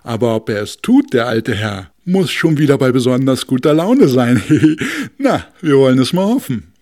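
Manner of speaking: loud blustery voice with laugh; north wind. Acted. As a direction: boom it with a chuckle. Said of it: "sagte der Nordwind".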